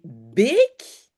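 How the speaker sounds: long closed é in "bec"; "bec" with é as in French "sérum". Shown as a demonstration. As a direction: say "bec" with a held, pure é. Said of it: "c'est plutôt comme sérum".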